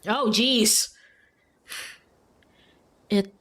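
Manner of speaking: ordinary talk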